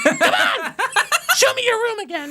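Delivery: shrill little Toad cry. Acted. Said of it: "She's fucking Toad".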